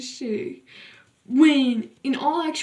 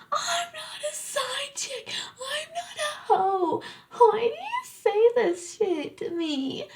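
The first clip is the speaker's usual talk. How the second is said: much higher, tearful mock scream; screaming and crying; 13 semitones higher, 3 dB quieter than usual. Girl screams at her boyfriend in the field, crying. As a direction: wail it at him like a hurt girlfriend. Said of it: "started screaming at him".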